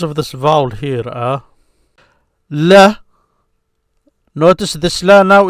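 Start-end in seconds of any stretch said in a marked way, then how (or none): 2.5-3.0 "la" sounded alone, loud and higher; teacher's isolated, projected "la"; syllable demonstration. Shown as a demonstration. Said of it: "break it down to syllables".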